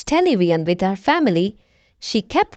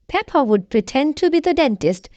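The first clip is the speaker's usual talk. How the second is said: ordinary delivery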